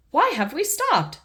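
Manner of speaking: put-on high little girl piggy voice; a curious small girl asking; Peppa's voice. Peppa asking Daddy why the car stopped, high and curious. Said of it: "asked Peppa".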